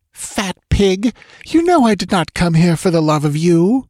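high-pitched angry retort; indignant woman. A narrator's reading. Dancer insults the priest who mocks her, indignant.

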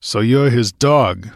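slow, gruff contempt; grunted. Acted. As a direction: grunt the insult. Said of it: "grunted Conan".

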